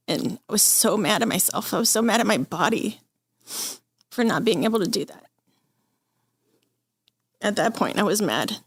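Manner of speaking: fighting tears while admitting anger at herself; emotional. Real, not acted. Patient recounting breaking down, tearful and unsteady. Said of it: "I'm gonna get very emotional".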